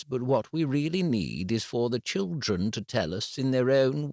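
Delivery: a calm, careful head teacher; composed school head breaking bad news. A narrator's reading. Head teacher explains calmly.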